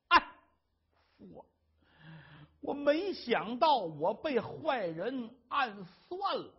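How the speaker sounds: slow, halting confession; faltering wife. Acted. Wife slowly confessing to her husband, halting. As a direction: force out the words one by one.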